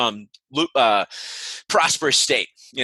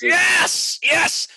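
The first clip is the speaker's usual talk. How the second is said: excited shout. Real, not acted. Cheering on local weed sales.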